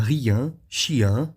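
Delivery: "rien, chien" ending in a nasal "an" sound; nasal vowel closing "rien, chien"; French nasal ending. Shown as a demonstration. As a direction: say "rien, chien" as "riã, chiã", no final n. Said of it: "Você vai pronunciar".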